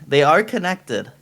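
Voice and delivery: usual speaking voice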